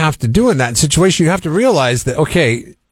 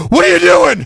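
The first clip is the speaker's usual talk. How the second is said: startled passenger; 9 semitones higher, 4 dB louder than usual. Pretend. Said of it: "and he's like".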